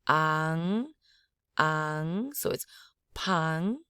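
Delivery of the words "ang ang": ANG drawn out through the nose; nasal vowel. Shown as a demonstration. Teacher adding the vowel, nasal ANG. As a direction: hold ANG with a nasal ring. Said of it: "Add in that nasally".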